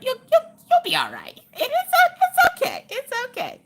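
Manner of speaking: put-on baby-talk it up high to soothe; cutesy reassurance. Comforting Miss Sullivan in chat, high and cutesy.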